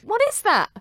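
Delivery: high, loud baffled question; mock bafflement. Host reacting to Jan's strange gadget, mock bafflement.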